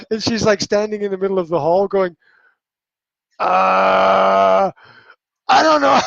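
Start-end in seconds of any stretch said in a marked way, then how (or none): 3.4-4.7 long, loud, level "uh"; mock flustered drone; overwhelmed groan. Imitates overwhelmed partner in the hall. 5.5-6.0 high, loud mimicked helplessness; mock-frantic cry; flustered outburst. Acts out overwhelmed partner.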